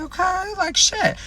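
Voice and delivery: very high, small voice; uneasy reaction. Retold or imitated. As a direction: squeak out a mock-nervous okay.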